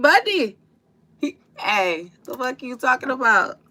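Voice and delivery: high, loud "Buddy"; mock exclaim. Retelling the movie, he yells a loud high "Buddy". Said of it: "He was like".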